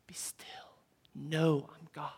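whisper. Retold or imitated. God's command whispered after shouting.

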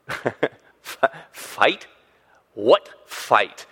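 halting, stuttered disbelief with gaps; mock puzzlement. Imagined David answers his brother, mock-puzzled. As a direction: play baffled, as if searching for it.

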